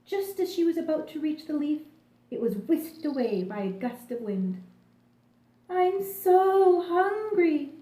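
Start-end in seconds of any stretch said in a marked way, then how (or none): 5.7-7.7 high, loud, very slow drawn-out wail; a starving grasshopper crying out in the snow. A narrator's reading. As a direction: stretch it into a hungry lament. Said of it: "she wailed".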